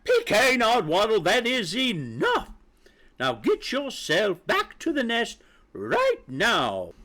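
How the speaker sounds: high, exasperated mother hen; barked order. A narrator's reading. Aggravated mother orders chick back to nest, barking. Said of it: "and barked".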